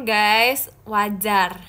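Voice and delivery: ordinary talk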